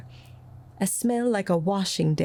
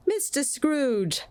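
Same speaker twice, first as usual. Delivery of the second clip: high, slow toasting voice; raised toast; 9 semitones higher, slower than usual. A narrator's reading. Bob toasting his employer, high and slow. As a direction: raise a glass solemnly. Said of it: "said Bob".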